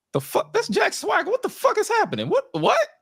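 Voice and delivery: very high, squeaky disbelief; baffled, breaking it into astonished little questions; mock incredulity. Acting out reaction to own tweet.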